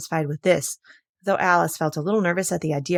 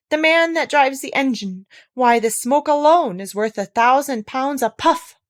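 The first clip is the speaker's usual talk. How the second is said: chorus voice; 6 semitones higher, 5 dB louder than usual. Acted.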